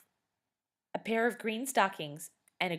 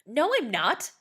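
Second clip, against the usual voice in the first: loud, higher, angry retort; angry denial; 7 semitones higher, 9 dB louder than usual. A narrator's reading. Scott answering his sister's insult, loud angry retort. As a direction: snap back at your sister. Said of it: "Scott said angrily".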